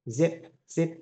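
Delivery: teacher models the z of "zip" for the class; z sound. Shown as a demonstration. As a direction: buzz the "z" in "zip". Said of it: "âm này là âm z".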